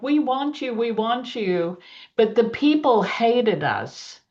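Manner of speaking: ordinary delivery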